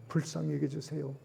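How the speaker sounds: fearful Ninevites praying 불쌍히 여겨주세요 quietly; soft plea. Acted. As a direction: hush 여겨주세요 as a humble prayer. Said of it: "하나님의 말씀 앞에 두려워서".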